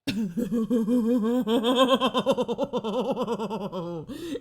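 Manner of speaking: long, loud burst of real laughter rising at the end. Real, not acted. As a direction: crack up helplessly at something absurd.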